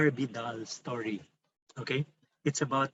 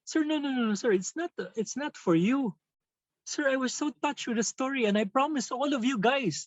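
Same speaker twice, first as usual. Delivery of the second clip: put-on higher, pleading voice of a moved teenager; tearful student voice; 10 semitones higher than usual. Student tells his teacher he was touched, higher pleading voice. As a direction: plead like a boy too touched to hold back. Crying student explains himself.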